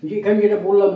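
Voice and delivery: ordinary talk, nothing marked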